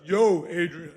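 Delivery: light mumbled Rocky voice; a hint of Rocky's drawl; Rocky impression. Correcting his friend's Rocky line, lightly voiced Rocky. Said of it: "You have to say yo first".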